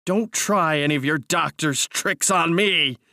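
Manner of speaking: high, drawn-out angry cry; furious. A narrator's reading. Narrator refusing his cousin's tricks, furious. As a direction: warn him off, hitting the words hard. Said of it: "I cried, trembling with fury".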